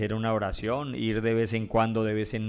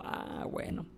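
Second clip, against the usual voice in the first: low, hushed giving-up; mock resignation; 9 semitones lower, 13 dB quieter, slower than usual. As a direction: drop to a near-whispered concession. Acted giving up on warning.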